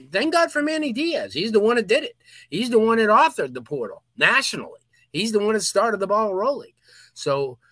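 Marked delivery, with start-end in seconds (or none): 0.1-6.7 excited. Real, not acted. Fired-up praise for Manny Diaz.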